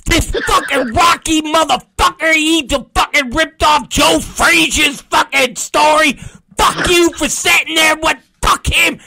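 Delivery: high, screaming tirade; hammed-up furious yell; mock angry shout. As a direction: shriek it at them from a passing car. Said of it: "and they're like".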